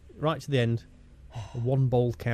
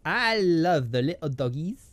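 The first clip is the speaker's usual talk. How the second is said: hammed-up South London Frenchie, slowing and rising; South London French accent. Clowning for friend about doggies, South London French accent. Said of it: "a sort of South London Frenchie".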